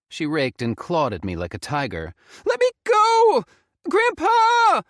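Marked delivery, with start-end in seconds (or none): 2.5-4.8 high, loud shrieking plea; desperate scream. Acted. Faye struggles and screams for grandpa, loud.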